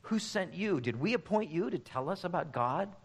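mock indignant challenge, pitched a bit up; lightly acted scornful retort; echoing scornful king. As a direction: imitate a dismissive crowd rebuffing a stranger. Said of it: "echo Amaziah and his crew".

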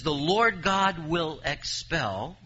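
The Lord God will expel, slow and loud; slow emphatic reading. Acted. Preacher reading verse five to the church, slow and emphatic. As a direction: stretch each word of the promise. Reads verse slowly, louder.

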